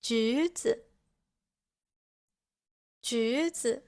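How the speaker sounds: rising 橘, quick light 子; neutral tone. Shown as a demonstration. Teacher giving Chinese examples, light neutral tone on 子. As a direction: say 橘子 with 子 short and unaccented. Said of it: "the second character has a neutral tone".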